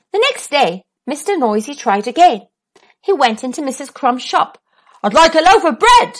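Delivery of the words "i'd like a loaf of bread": loud booming voice. Acted. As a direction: boom the order. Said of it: "he boomed".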